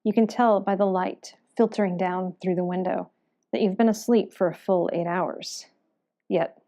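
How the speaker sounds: measured, subdued scene setting; game master narration. Acted. Waking a weary adventurer in the morning, game master narration. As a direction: narrate the scene in second person, with pauses.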